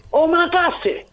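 put-on high-pitched kid calling to mom; niño llamando a su madre. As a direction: act out the child's high call from the kitchen. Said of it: "a hacer otra vez el diálogo". (niño que llama a su madre, muy agudo)

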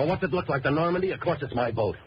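high, exasperated comeback; irritated sarcasm. Acted. Boatman snapping back at a pushy gunman, irritated sarcasm. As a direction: throw it back high, easing down at the end. Sarcastic answer to pressed question.

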